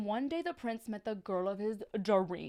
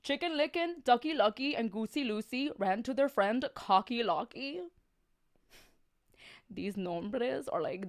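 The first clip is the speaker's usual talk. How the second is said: ordinary delivery, nothing marked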